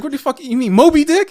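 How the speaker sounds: high, loud incredulous question; exasperated disbelief. Retold or imitated. Retold correction escalates into disbelief.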